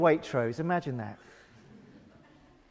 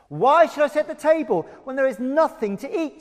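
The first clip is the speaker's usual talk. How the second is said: higher, whiny complaint; grumbling housemaid; 8 semitones higher, 3 dB louder than usual. Acted. Housemaid Lulan complaining there is no breakfast, whiny. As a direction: sulk about setting the table. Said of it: "she grumbled".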